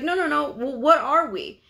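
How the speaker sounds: high cut-in, pressing question; indignant insistence. Retold or imitated. Partner rejecting the provider's excuse, indignant insistence. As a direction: refuse the excuse and press, acted out.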